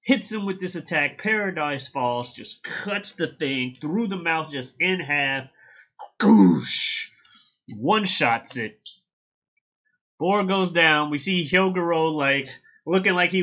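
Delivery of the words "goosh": loud whooshing "goosh"; slicing sound effect. Retold or imitated. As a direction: burst out an imitated slash impact. Imitating the sword slicing the boar.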